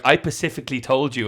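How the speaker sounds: "specifically" said pacifically, without the s; mimicked mispronunciation. Quoting people who get it wrong, "specifically" as pacifically. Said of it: "people will say Pacific".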